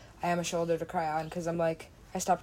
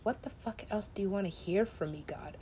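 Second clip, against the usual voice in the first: whisper; 8 dB quieter than usual. Real, not acted. Exasperated aside to the game.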